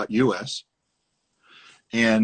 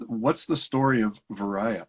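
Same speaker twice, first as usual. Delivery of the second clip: normal talking voice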